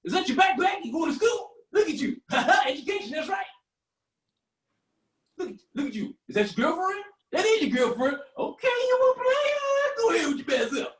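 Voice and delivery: put-on old-timer's high, whooping banter; old man hollering. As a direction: heckle kids playfully from the bus stop. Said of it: "be like".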